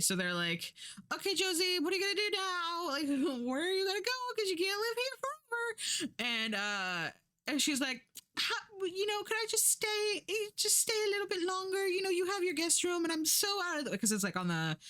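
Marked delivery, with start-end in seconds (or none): 1.1-6.1 much higher, sugary mock-polite voice, quickened; hammed-up politeness hiding the push to leave; fake-polite hosts. Hosts politely ask guest to leave. 8.4-14.0 much higher, whiny mock plea, quickened; hammed-up begging to stay a little longer; pleading guest. Josie begs to stay longer.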